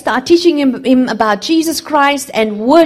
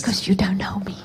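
tender whisper; 2 semitones lower, 6 dB quieter, faster than usual. Retold or imitated. God speaking to the boy on the dump: tender whisper. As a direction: breathe it like a loving father.

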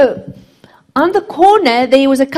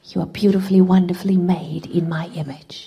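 tender whisper; 4 semitones lower than usual. Retold or imitated. God speaking to the boy on the dump: tender whisper. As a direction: breathe it like a loving father.

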